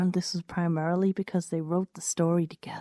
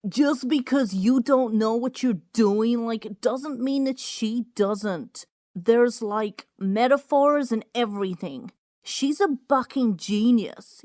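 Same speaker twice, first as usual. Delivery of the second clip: higher, slow indignant guy voice; a higher, sulky defender; defensive classmate; 5 semitones higher than usual. Acted. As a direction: stick up for her with scorn. Classmate defends her friend.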